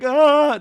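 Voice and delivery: high, loud imitated wail; mock distressed cry. Imitating Nia breaking down, mock wail. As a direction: let out a hammed-up "ah" of distress. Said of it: "Nia's, like, breaking down internally".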